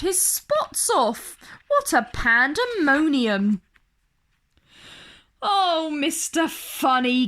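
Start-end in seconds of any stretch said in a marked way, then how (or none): 5.4-7.3 a light giggle in the voice, a bit higher; giggling. A narrator's reading. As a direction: zookeeper giggling his thanks. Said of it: "giggled the zookeeper".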